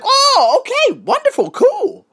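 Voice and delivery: squeaky high startled okay; high-pitched fake-cheerful cool; mock surprise. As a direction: hide the shock behind a bright okay. Shocked reaction, retold.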